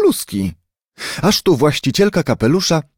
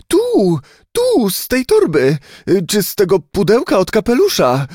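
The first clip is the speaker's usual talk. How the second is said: very high, anxious pointing cry; frightened answer; 9 semitones higher than usual. A narrator's reading. Frightened lady pointing at the luggage, anxious high answer. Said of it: "odpowiedziała wystraszona dama".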